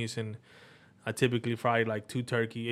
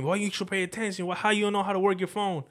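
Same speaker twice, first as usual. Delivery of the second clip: mild mock yell at mom, pitched up; exasperated scolding, raised pitch more than volume; retold scolding yell; 6 semitones higher than usual. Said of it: "that's why I yell at my mom".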